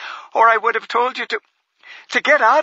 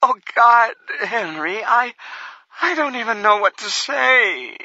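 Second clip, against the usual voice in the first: much higher, faltering, on the verge of tears; a tearful wife stumbling for words; stuttering whine; 12 semitones higher than usual. Acted. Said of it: "she stuttered; throttled high like a whine".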